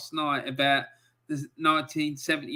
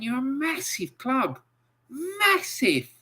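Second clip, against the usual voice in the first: much higher pitched, incredulous push on massive; exasperated emphasis; 10 semitones higher than usual. Real, not acted. Host ranting at Everton fans, incredulous higher pitch. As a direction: stress how big the club is, disbelieving.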